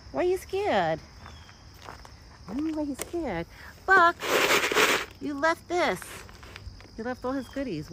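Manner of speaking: coaxing, high-pitched voice to a pet; gently high-pitched, calming a startled animal; high pet talk. Real, not acted. Coaxing her scared deer Buck.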